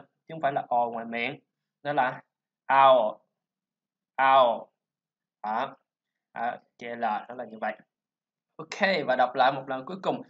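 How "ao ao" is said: "ao" ending in an L back in the throat; throaty dark L. Shown as a demonstration. Teacher demonstrating owl, "ao" with a throaty final L. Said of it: "L, O ở trong cái cổ họng".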